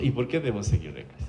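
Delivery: low, very soft imitated question; understated child's challenge, dropped low and quiet; self-assured child. Niño de siete años en consulta, aplomo imitado en voz baja. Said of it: "con una solvencia absoluta".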